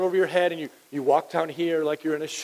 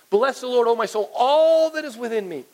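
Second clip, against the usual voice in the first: higher, exuberant praise, "all" stretched out; excited; 5 semitones higher than usual. Acted. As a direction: burst with thanks as you quote. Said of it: "he's just so excited".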